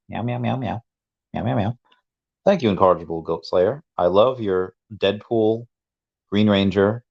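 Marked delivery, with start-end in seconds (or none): 0.1-1.8 quiet playful meowing; soft cat meows. Pretend. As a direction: meow softly like a cat. Greets viewer Chubby Cat.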